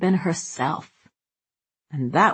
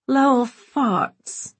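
high, slow, tipsy society lady; tipsy giggle; 7 semitones higher, much slower than usual. Acted. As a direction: giggle it out, a little drunk. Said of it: "she'd pronounced with a tipsy giggle".

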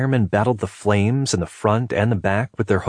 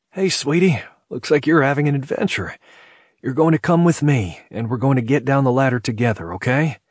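put-on higher, coaxing talk to a child; smiling; 5 semitones higher than usual. A narrator's reading. Fireman coaxes little girl at the window, smiling. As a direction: make it sound like a fun adventure. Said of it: "He smiled widely at Emily and said".

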